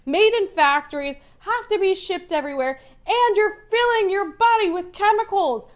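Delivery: high-pitched, exasperated rant; fired-up rant. Real, not acted. Ranting to the group about fake meat, fired up. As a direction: get fired up and push the pitch up. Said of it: "This one's gonna get me fired up".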